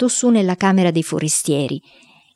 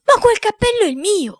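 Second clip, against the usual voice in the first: high-pitched cry of anguish; alarmed, high wail of protest. A narrator's reading. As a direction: cry out in distress, voice shooting up. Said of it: "gridando con angoscia".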